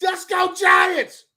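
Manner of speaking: high, stretched-out fan cheer; retold victory yell. Fan on a FaceTime call running outside, victory yell.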